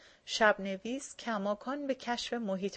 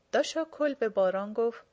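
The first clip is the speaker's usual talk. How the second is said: ordinary delivery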